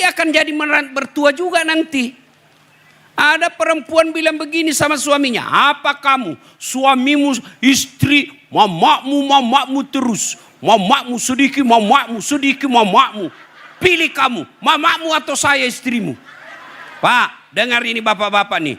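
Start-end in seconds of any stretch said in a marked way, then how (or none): 5.5-16.2 mock nagging wife, a bit higher; lightly voiced scolding wife. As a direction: play the wife demanding he choose. Wife scolding husband over his mother.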